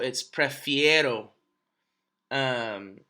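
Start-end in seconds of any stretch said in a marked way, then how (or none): 0.2-1.3 prefiero said with the stem vowel as "ie", pre-FIE-ro; correct form. Shown as a demonstration. Teacher to students: models the right form prefiero, clearly. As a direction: stress the ie in prefiero. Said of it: "So instead of".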